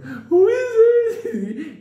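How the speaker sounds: very high, slow crying question; Benny asks who is there, terrified; terrified squeak. Retold or imitated. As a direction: hold the zipper and squeak in terror. Holding the zipper, terrified.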